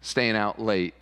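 ordinary delivery

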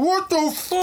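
very high, stunned; shocked gasp of a question. Acted. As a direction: squeak it out in shock. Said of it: "he goes".

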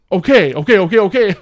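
hammed-up impatient robber; mock exasperation. Retold robber losing patience.

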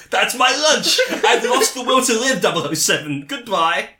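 high, loud hammed-up despair; mock despairing Q. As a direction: wail it like a melodramatic goodbye. Q despairing comically.

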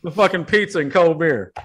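high-pitched ranting dad; exasperated rant. Acted. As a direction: rant on and on at a useless son. Acted father berating son over pizza.

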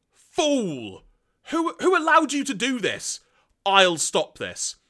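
high, loud villain indignation; hammed-up furious scolding; acted outrage. Galvatron berating Zarak for planting the bomb, outraged.

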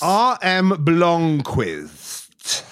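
very slow, drawn-out name; grand self-announcement. Acted. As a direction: announce yourself like a showman. Contestant announces character name.

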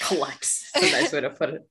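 laughing through the words. Real, not acted. A co-host laughs at the word collects, laughing. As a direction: chuckle as you repeat it.

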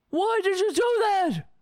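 high, loud, pleading cry; mock anguished plea to the police officer; distraught cry. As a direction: act out a shocked victim wailing at the shooter. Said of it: "you start crying and screaming".

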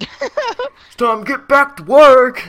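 high, loud, slow drawn-out yell; teasing yell to the coworker hiding in the bushes. Retold or imitated. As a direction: bellow it at the bushes.

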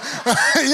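high bright laugh breaking out mid-story; burst of laughter. Real, not acted. Friend retelling his altar call, laughing. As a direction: laugh out loud at the memory.

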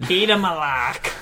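Boston accent, lightly put on; a quick jokey Boston accent. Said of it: "There's my Boston accent".

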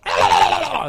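a breathless unvoiced caw; airy crow caw. Shown as a demonstration. Pastor imitates a crow call, unvoiced and sharp. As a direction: push out a caw on pure air. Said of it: "Sometimes they'll go".

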